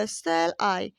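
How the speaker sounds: "shall I" said low and drawn out; unstressed SHALL I. Shown as a demonstration. Teacher showing learners an unstressed foot, "shall I" said gently. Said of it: "मतलब धीरे से बोला".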